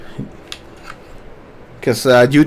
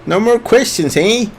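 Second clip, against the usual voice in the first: Canadian, higher and teasing; Canadian accent; 6 semitones higher than usual. Pretend. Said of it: "that's my Canadian accent".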